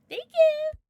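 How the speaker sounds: high, loud thank you; excited thanks. Real, not acted. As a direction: sing out the thanks. Thanking a mod.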